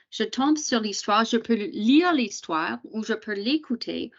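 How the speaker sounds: ordinary talk, nothing marked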